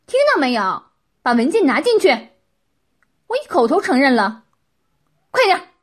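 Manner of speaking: loud, high-pitched angry order; stern, sharp command. A narrator's reading. Qin orders the document taken inside, sharp and stern. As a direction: demand obedience at full volume. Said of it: "秦厉声道".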